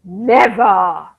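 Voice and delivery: low, stubborn growl on never. Acted. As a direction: bite down on never, low and final. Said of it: "He growled".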